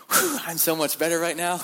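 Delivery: high, loud sigh of relief; relieved. Retold or imitated. Prisoners back from reading, sighing with relief.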